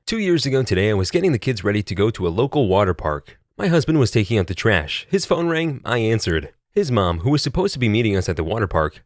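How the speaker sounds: ordinary delivery, nothing marked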